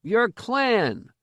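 put-on high-pitched boyish demand. A narrator's reading. Boy questions the stranger about clan, demanding. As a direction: demand an answer, pitched well up. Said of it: "the boy demanded".

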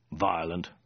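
mildly tired afterthought; weary reply. A narrator's reading. Khan gazing back at the fire, weary word. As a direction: drop the word wearily.

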